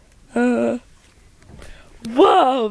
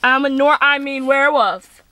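ordinary talk, nothing marked

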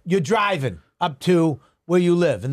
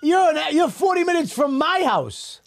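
much higher, insistent objection; high, heated comeback; high exasperated protest; 10 semitones higher than usual. Real, not acted. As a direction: argue it back with pitch pushed way up. Arguing over travel time.

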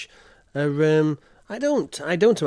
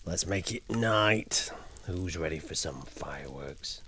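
hushed hype; 8 semitones lower, 11 dB quieter than usual. Real, not acted. Hyping chat, hushed.

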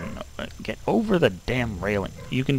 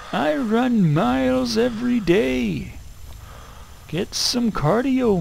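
mocking taunt; 10 semitones higher, slower than usual. Mocking enemy character.

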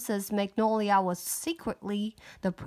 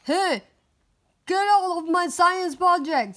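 higher, louder angry yell; angry shout; 8 semitones higher, 8 dB louder than usual. Acted. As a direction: yell at the intruder. Tommy yells at goo.

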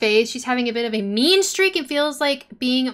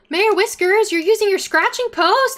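high, loud proud cooing; delighted gushing; 7 semitones higher, 5 dB louder than usual. Real, not acted. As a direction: gush proudly over a pet. Praising cat for using post.

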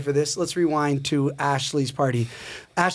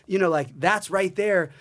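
normal talking voice

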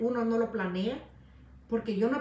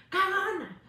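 taunting "cagona" pitched up high; mocking crowd jeer, rising to a louder "cagona"; 8 semitones higher than usual. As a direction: jeer "cagona" like a heckler, louder the second time. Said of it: "que le llamen".

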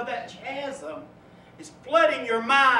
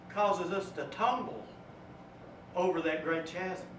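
ordinary delivery

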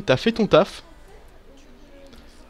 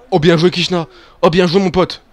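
excited cheer; 3 semitones higher, 6 dB louder than usual. Real, not acted. Cheering his player's move.

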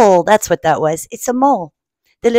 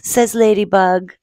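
usual speaking voice

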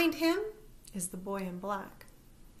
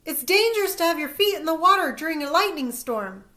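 loud, high urgent warning; yelled warning; 7 semitones higher, 11 dB louder, faster than usual. A narrator's reading. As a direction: warn him from a distance. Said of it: "I yell".

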